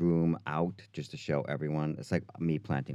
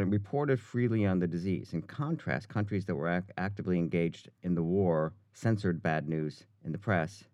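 ordinary talk

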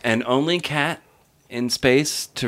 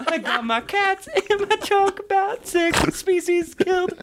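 squeaky silly voice. Pretend. Silly voice while laughing.

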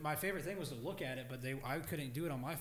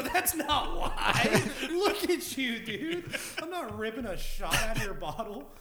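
slow laughing protest, high and loud; 10 semitones higher, 13 dB louder, slower than usual. Retold or imitated. Turning down the homeless guy's vodka, laughing loudly. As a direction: laugh through the refusal.

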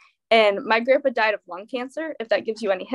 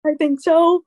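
mock tearful, higher and louder; retold upset, pitched up; shaky and tearful; 7 semitones higher, 6 dB louder than usual. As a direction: imitate a teary, unconvinced reply. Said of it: "I started shaking and crying".

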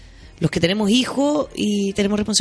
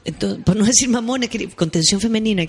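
usual speaking voice

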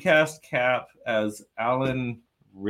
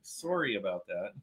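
softer mock-Canadian "sorry"; imitated Canadian accent; 3 semitones lower, 10 dB quieter than usual. Co-host making fun of his friend's accent, quiet mock-Canadian. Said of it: "sounds a little Canadian when he says sorry".

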